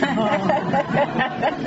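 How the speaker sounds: bright burst of laughter; amused laugh after a mock demo. Real, not acted. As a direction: laugh at your own parody. Laughs at own parody.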